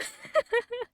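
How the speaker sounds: high-pitched squeal of laughter; real squealy laugh. Real, not acted. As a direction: burst into a shrill giggle.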